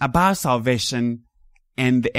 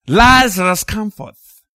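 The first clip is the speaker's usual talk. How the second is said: loud cry; 5 semitones higher, 6 dB louder than usual. Acted. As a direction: call Lazarus out of the tomb. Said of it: "he cried with a loud voice".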